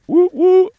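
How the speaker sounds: very high, loud two-note hoot; owl hoot. Shown as a demonstration. Streamer attempting an owl sound for chat, high hooting. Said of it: "How does an owl sound?".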